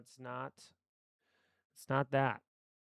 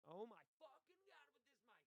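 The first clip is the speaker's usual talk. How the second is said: frustrated outburst; 12 semitones higher, 20 dB quieter than usual. Real, not acted. Mic keeps cutting out.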